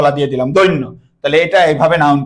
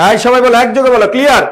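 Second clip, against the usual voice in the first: calling out; 8 semitones higher, 4 dB louder, faster than usual. Real, not acted. Teacher calling class to answer.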